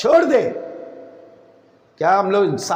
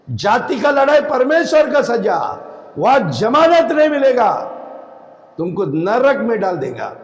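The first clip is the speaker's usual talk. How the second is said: usual speaking voice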